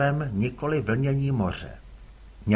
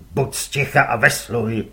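louder gruff curt reply; gruff father; 5 semitones lower, 6 dB louder than usual. Acted. Father answers his son curtly, gruff.

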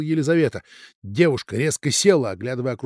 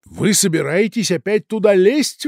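raised, higher voice in disbelief; exclaiming; 7 semitones higher, 5 dB louder than usual. A narrator's reading. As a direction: exclaim it, alarmed. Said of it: "воскликнул Раневский".

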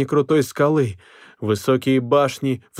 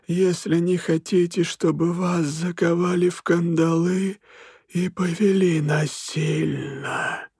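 slow, cold, eerie spirit voice; icy otherworldly voice; 6 semitones higher, slower than usual. A narrator's reading. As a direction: greet the prince like a ghostly king. Голос Смергла. (ледяной потусторонний голос)

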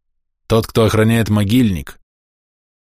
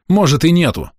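harsh; 8 semitones higher, 3 dB louder than usual. Acted. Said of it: "жёстко ответил Павел".

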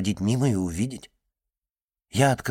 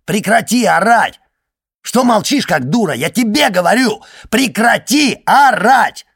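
high, very loud screamed order; furious yelling; 11 semitones higher, 10 dB louder than usual. A narrator's reading. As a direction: yell in rage, building to a stretched final scream. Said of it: "это Алиса орёт". (яростный ор)

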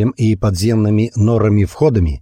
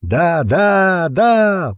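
high ragged cheering; crowd shouts; 9 semitones higher, much slower than usual. A narrator's reading. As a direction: cheer like a rabble. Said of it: "послышались нестройные крики".

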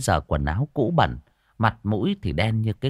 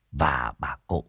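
ordinary delivery, nothing marked

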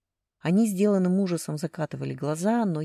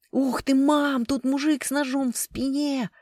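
put-on high, excited kid's cry; loud, high-pitched glee; delighted shout; 9 semitones higher, 4 dB louder, slower than usual. A narrator's reading. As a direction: call out to mum in thrilled wonder. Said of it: "раздался восхищённый крик из коридора".

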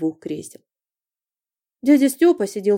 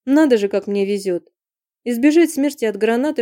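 usual speaking voice

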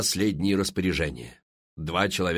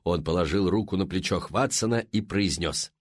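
ordinary delivery, nothing marked

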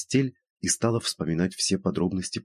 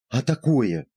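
higher, emphatic retort; mild cry; 6 semitones higher, 4 dB louder, slower than usual. A narrator's reading. Woman retorting to her doubting friend, mild cry. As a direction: exclaim it with restrained force, as in storytelling. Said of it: "крикнула Евгения".

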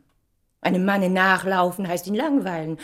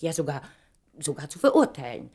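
normal talking voice

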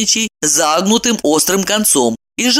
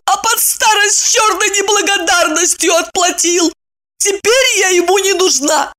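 tragic heroine sorrow; 12 semitones higher than usual. Acted. Wronged wife rants about husband.

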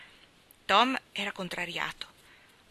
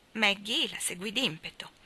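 ordinary delivery, nothing marked

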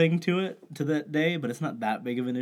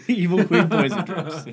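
laughing through the words; giggling the phrase out; 6 semitones higher, 8 dB louder than usual. Real, not acted.

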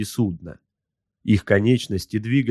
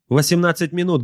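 high-pitched subordinate's report; high-voiced crew slave reporting; servile gunnery slave; 8 semitones higher, 3 dB louder than usual. A narrator's reading. Slave reports time to master.

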